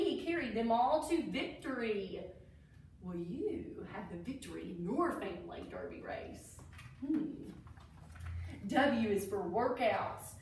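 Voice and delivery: ordinary delivery, nothing marked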